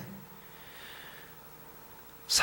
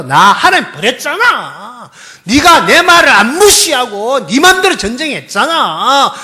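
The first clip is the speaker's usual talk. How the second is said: God's accusation; 10 semitones higher, 10 dB louder than usual. Acted. Preacher voicing God accusing Saul, loud and reproachful. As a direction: press each accusation in God's voice.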